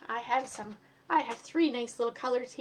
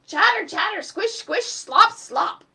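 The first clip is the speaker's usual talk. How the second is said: noisy sound effects; 8 semitones higher, 11 dB louder, slower than usual. Acted. Narrator acting out muddy tourists, loud and high. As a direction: chatter and squelch, high and loud. Storyteller voicing tourist noises.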